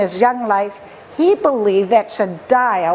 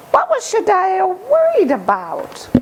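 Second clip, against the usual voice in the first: put-on high, light boy voice; carefree boy; 9 semitones higher than usual. Boy in the forest shrugging off warnings, high and light. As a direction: toss it off, high and unbothered. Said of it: "he said aloud".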